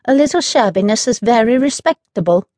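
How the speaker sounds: pleased with her own remark; satisfied. Acted. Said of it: "she added in a satisfied voice".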